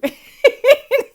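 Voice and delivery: high, loud helpless laugh; burst of laughter. Real, not acted. Laughing out loud to her viewers about the wizard. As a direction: cracks up remembering the call.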